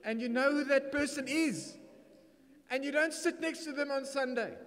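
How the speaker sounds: high, loud, playful preacher's cry; preacher calling out the pew-dodgers; loud high preaching. Real, not acted. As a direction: belt it up high to tease the crowd. Preacher's teasing punchline to congregation.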